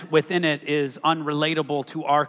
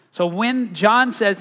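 normal talking voice

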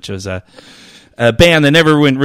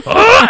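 high moan; 4 dB louder than usual. Retold or imitated. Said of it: "and my dick went".

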